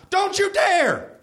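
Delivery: high, drawn-out mock yell; acted yell. A narrator's reading. Narrator plays Jim stopping Sam, high mock yell. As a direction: yell it high and slow as a warning. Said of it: "yelled Jim".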